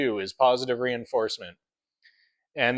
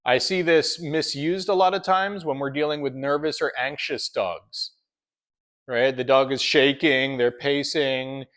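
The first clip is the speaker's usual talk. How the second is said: ordinary talk, nothing marked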